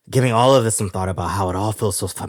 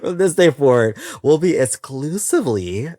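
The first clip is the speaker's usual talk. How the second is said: louder and higher, punched out; emphatic declaration; 6 semitones higher, 4 dB louder than usual. Acted. Announcing the new format to listeners, loud and proud. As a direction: proclaim it with gusto. Repeats vow after laughing.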